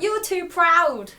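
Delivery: Katniss. Pretend. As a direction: draw out a playful scolding. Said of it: "she's like".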